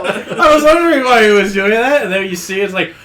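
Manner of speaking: ordinary talk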